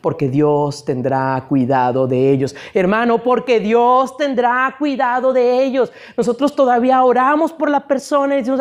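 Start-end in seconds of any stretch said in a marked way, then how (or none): 0.0-2.6 much lower, level and subdued; low-pitched delivery. Shown as a demonstration. Preacher stating God will care for them, in a low voice. Same phrase said low, then emphatically. 3.2-5.9 lifted, insistent preaching voice; raised, emphatic. Shown as a demonstration. Preacher assuring a brother God will care, raised and emphatic. Same phrase repeated high to brother.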